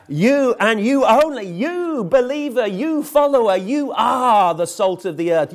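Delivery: ringing, insistent poster call; finger-pointing recruiter. Acted. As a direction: proclaim it straight at each listener. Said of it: "pointing his finger at the viewer".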